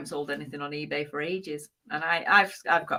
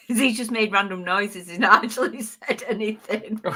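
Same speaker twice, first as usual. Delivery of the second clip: higher-pitched, talking through laughter; laughing; 4 semitones higher, 4 dB louder than usual. Real, not acted. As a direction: laugh helplessly while you say it. Laughing at failed accent attempt.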